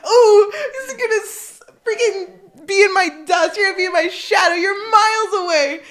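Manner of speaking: high, loud yell, breaking into laughs; retold taunting yell. Swimmer trash-talking her sister mid-race, retold yelling. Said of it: "I kept yelling at her".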